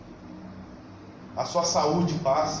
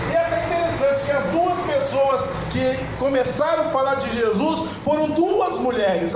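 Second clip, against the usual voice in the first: raised, forceful sermon storytelling; fervent preaching; 5 semitones higher than usual. Real, not acted. Preacher telling how Ana announced the Messiah, fervent preaching. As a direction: build to a high-pitched proclamation.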